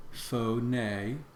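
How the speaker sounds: Phone said foh-NAY with both syllables clear; Phone in two beats, foh-NAY, rising at the end. Shown as a demonstration. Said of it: "This first word has two syllables".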